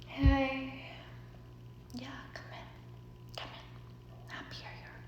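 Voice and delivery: spaced-out, fading into a whisper; drugged-out girl. Retold or imitated. Drugged hostess greeting her at the door, spaced-out whisper. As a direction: drift off, barely there. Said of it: "drugged out of her fucking mind".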